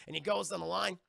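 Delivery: ordinary talk, nothing marked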